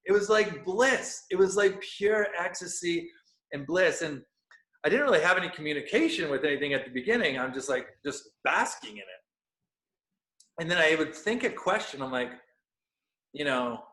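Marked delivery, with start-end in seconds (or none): none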